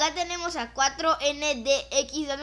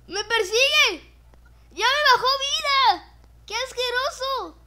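frightened shout; 12 semitones higher, 8 dB louder, slower than usual. Real, not acted. Chased in game.

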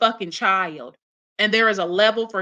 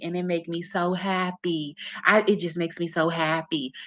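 mocking mother's confession; 2 semitones lower, 6 dB quieter than usual. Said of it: "That's like you sitting there saying".

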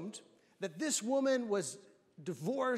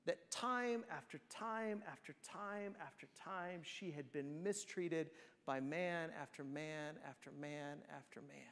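hushed; 6 semitones lower, 10 dB quieter than usual. Real, not acted. Preacher stresses repeated mistreatment.

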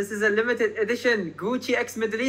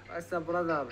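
normal talking voice

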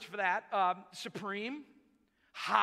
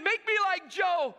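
loud, high, tearful shout; crying plea; 8 semitones higher, 5 dB louder than usual. Retold or imitated. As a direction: cry out the plea, imitating tears. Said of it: "and he's crying".

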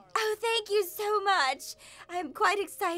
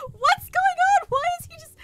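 high, loud, flustered questions; giddy, high-pitched disbelief; flustered squeal; 12 semitones higher, 6 dB louder than usual. Real, not acted. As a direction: blurt it out high, overcome by the crush. Flustered near the crush.

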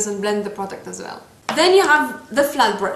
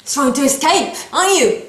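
teasing brush; 4 semitones higher, 5 dB louder than usual. Pretend. Talking to the runaway brush.

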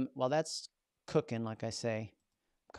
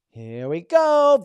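much higher and louder, slow announcement; excited build-up; 11 semitones higher, 17 dB louder, much slower than usual. Real, not acted. Host unveiling the AI's script to viewers, excited. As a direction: announce it like a big reveal.

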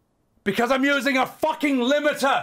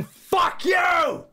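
slow, higher furious yell; mock rage outburst; 8 semitones higher than usual. Streamer suddenly yelling at viewer, hammed-up rage. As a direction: snap into sudden fake fury. Insulting viewer mid-tutorial.

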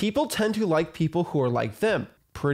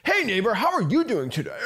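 higher, overdone salesy cheer; a wholesaling guru opening a cold call with fake warmth; fake guru greeting; 5 semitones higher than usual. Shown as a demonstration. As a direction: put on a fake friendly guru voice. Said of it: "That's, like, guru stuff".

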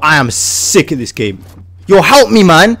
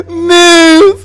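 squealed plea; 13 semitones higher than usual. Real, not acted. Gamer squealing at a teammate blocking him. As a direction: yelp it through a laugh.

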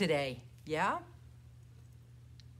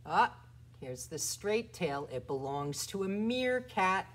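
normal talking voice

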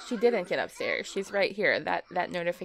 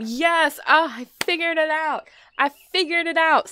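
higher, louder triumphant shout; 6 semitones higher, 9 dB louder than usual. Real, not acted. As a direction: cheer the win. Triumph at solving the game.

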